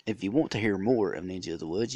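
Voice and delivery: normal talking voice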